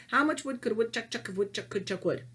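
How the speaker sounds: quick, fluent tongue twister; practised recital. Shown as a demonstration. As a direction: reel it off from memory in one run. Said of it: "what I used to say is".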